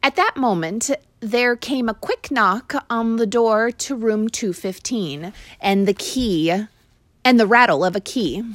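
ordinary delivery, nothing marked

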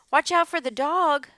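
loud, high cry of warning; sheep crying out. A narrator's reading. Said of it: "cried the sheep".

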